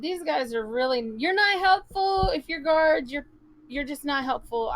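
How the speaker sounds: high, loud, fed-up scolding; exasperated. Acted. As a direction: tell the guards off in frustration. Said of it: "I said".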